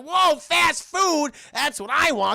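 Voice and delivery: loud, high, drawn-out delight; greedy excitement. Retold or imitated. Eustace hears of free food, greedy excitement. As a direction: swoop up in mock awe.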